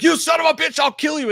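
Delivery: loud, high-pitched furious mock yell; yelling at the killer. Host re-enacting the movie hero threatening the killer, yelling. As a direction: imitate a raging shout at full tilt. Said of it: "when he's yelling at the killer".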